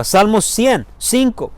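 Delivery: ordinary talk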